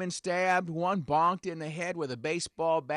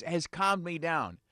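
ordinary delivery

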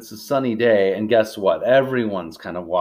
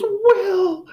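high, anxious cry of a name; acted alarmed call, pitched way up; mom calling out; 4 dB louder than usual. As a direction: call out for your son. Mom calls son after quake.

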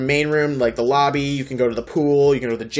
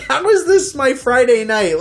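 laughing; 8 semitones higher than usual. Retold or imitated. Own inner voice, laughing.

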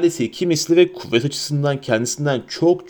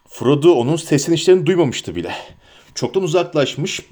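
ordinary delivery

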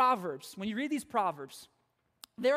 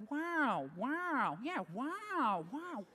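soft, drawn-out movie-star wow; quiet celebrity impression, long swooping vowel; Owen Wilson; 2 semitones higher, 5 dB quieter, much slower than usual. Shown as a demonstration. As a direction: stretch out a soft, airy catchphrase. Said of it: "in your best Owen Wilson impression".

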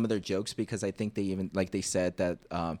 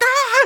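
very high, loud laughing shriek; mock scream; 14 dB louder than usual. Telling friends about Beast Boy, a loud high mock shriek. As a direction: shriek in cartoon terror. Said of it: "So he's just like".

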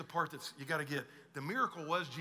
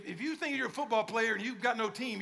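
ordinary talk

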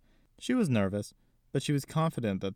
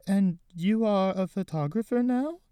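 put-on old lady; 11 semitones higher, 5 dB louder than usual. Gigi voiced as old lady.

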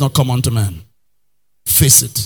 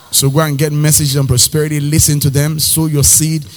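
ordinary delivery

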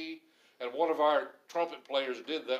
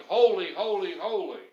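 loud ringing cry of holy; proclaiming holy at full voice; shouted praise; 2 semitones higher, 8 dB louder than usual. Retold or imitated. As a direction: call it out like angels in worship.

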